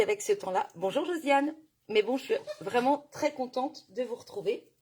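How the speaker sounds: usual speaking voice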